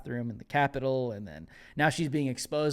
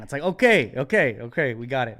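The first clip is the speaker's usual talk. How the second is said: exasperated okay; 8 dB louder than usual. Real, not acted. Dismissive reaction.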